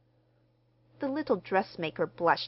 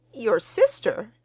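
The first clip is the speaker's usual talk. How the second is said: higher, loud, drawn-out question; startled, almost alarmed query; startled surprise; 4 semitones higher, 8 dB louder than usual. A narrator's reading. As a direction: echo it slowly, taken aback. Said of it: "look of startled surprise".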